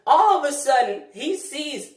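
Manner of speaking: a sudden alarmed burst; sudden excitement. Acted. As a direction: voice jumps up for the twist. Sudden turn in story.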